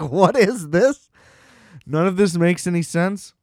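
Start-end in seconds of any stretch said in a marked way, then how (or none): 0.0-1.1 slow, puzzled question in an odd put-on voice; mock baffled. Host imitating himself seeing the trailer, slow mock bafflement. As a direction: act stumped by the silly title.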